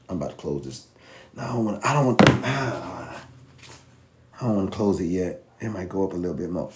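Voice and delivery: soft nervous muttering; quiet, torn, talking himself out of it; anxious trader. Acted. Trader staring at his chart, softly second-guessing himself.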